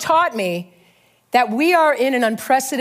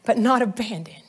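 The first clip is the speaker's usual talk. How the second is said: prophetic preaching; 4 semitones higher, slower than usual. Acted. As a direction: speak it as a word from the Lord over him. Prophetic word over the church.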